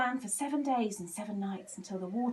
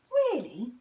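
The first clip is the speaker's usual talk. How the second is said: surprised; 6 semitones higher, 8 dB louder than usual. A narrator's reading. Classmates surprised.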